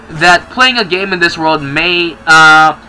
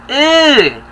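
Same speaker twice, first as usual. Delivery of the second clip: pained grunt; 9 semitones higher than usual. Acted. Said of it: "Lots of grunting".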